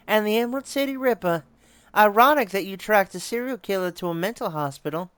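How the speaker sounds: high, playful character voice; game character's quip. Acted. Game character teasing the detective about the Ripper, high-pitched.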